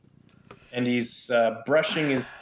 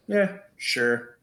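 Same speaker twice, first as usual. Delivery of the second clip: pitched-up, casual assent; verbal shrug; 7 semitones higher than usual. Acted. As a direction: agree with a shrug in the voice. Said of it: "he kinda gives a shrug".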